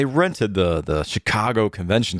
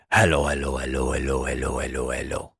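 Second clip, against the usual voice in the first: low character voice fading to soft; friend's podcast character; 8 semitones lower, 4 dB quieter than usual. Retold or imitated. Husband imitating a friend's podcast character, lower and fading. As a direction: start low and sink softer with each hello. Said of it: "he's got a character on there that comes on his podcast and goes".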